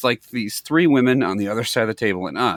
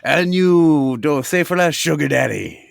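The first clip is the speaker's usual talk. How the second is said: slow, raised, loud showman's call; imitating chef's shouting; 4 semitones higher, 5 dB louder, slower than usual. Chef teasing the husband, mock shouting. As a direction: call it out slowly across the grill. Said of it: "he just kept shouting that".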